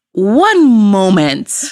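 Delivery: very slow, stretched phone reply; drawn-out reply. Retold or imitated. Woman on phone asks caller to wait, drawn out. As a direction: stretch each word for suspense.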